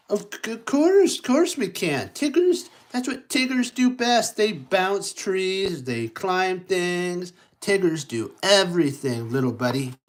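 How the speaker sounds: high, boastful Tigger, cocky and proud; bouncy Tigger voice. Acted. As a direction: show off like a bouncing tiger.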